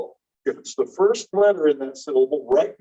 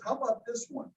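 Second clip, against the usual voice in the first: ordinary talk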